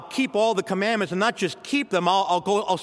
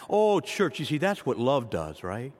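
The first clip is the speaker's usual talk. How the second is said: ordinary talk, nothing marked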